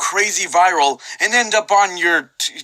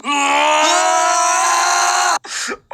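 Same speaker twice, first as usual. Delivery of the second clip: screaming laugh; 11 semitones higher than usual. Acted.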